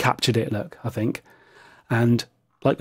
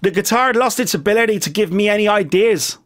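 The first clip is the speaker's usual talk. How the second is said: put-on American accent; 6 semitones higher than usual. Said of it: "he would have said it in an American accent".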